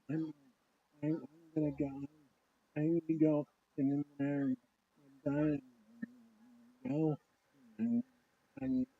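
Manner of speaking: halting, dazed sleepwalker mumble; incoherent rambling. Retold or imitated. Retold sleepwalking child answering parent, incoherent rambling. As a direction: trail through broken phrases with long gaps. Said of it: "incoherent rambling".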